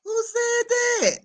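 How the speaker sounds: high-pitched mock-indignant catchphrase voice; Porsha. Streamer quoting a catchphrase for laughs, high put-on voice. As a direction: pitch it way up, drawing the words out. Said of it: "in my Porsche voice".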